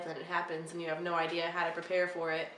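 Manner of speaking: ordinary delivery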